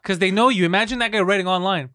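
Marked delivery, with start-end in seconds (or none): none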